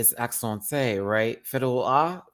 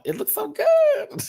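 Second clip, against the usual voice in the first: very high, slow, louder squeal; falsetto squeal; 7 dB louder than usual. Real, not acted. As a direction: stretch it out in a swooning squeal. Gushing over the look.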